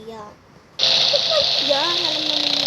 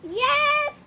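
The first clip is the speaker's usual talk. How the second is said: high-pitched squeal of victory; gleeful high yes; delighted cheer; 11 semitones higher than usual. Acted. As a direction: cheer as if you just won. Friend finally agrees to the ride.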